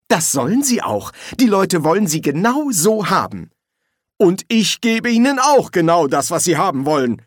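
lightly voiced Silberfisch. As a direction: defend your product emphatically.

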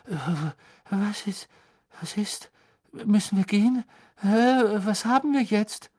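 high, halting, confused waking babble; startled awake. A narrator's reading. Hermine jolts from sleep at the desk, flustered.

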